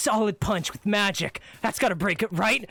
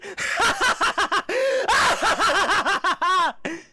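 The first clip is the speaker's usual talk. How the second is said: triumphant laugh; 10 semitones higher than usual. Acted. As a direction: laugh them off the field. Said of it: "jump at your laughter".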